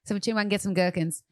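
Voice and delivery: lightly put-on British tourist voice, quick; playful mock British, fast start; mock British accent. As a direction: practise London slang in a mild British lilt. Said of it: "We can say".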